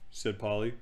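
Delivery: plain reading voice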